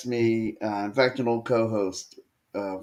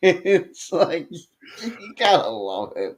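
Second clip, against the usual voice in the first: talking through laughter, higher; laughing; 8 semitones higher, slower than usual. Real, not acted. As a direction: laugh your way through the words. Laughing at his own story.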